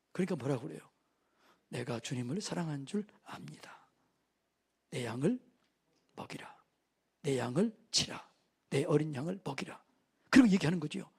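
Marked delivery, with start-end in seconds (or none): 1.7-9.8 soft near-whisper, slowing down; hushed reply. Acted. Peter answering Jesus, then Jesus charging him, hushed. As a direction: keep it hushed and earnest.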